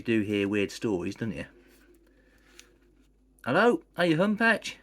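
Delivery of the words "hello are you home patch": high, loud call upstairs; calling from downstairs. Acted. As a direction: shout it up the stairs. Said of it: "A yell from downstairs".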